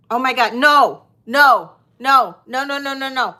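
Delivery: loud panicked no, no, no; frantic real denial of a mistake; anguished denial. Real, not acted. Panicked at wrong starting price.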